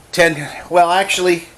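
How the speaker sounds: usual speaking voice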